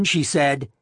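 ordinary delivery, nothing marked

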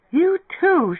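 high, loud cry; excited cry. A narrator's reading. Beth drags Lemming to his feet, crying out. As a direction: cry out high to pull them in. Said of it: "she cried in his astonished ear".

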